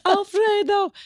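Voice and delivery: very high, plaintive calling; dying woman calling. Retold or imitated. Dying woman calling out for Alfredo, very high. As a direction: imitate her wailing the name. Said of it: "And she was like".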